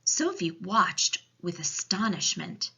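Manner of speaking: ordinary delivery, nothing marked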